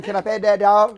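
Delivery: hammed-up southern question; southern accent. Friend reenacting the mall role-play in a southern accent. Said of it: "I think we were going".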